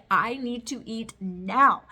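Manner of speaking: half-joking hangry outburst; mock hangry demand. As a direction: play your own hangry self, loud and drawn out. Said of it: "I get mad when I'm hungry".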